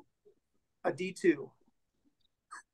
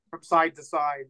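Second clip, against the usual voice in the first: ordinary delivery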